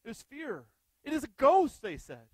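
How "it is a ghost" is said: loud high cry of terror, +6 st +13 dB; terrified cry. Acted. Frightened disciples shouting at a ghost on the water.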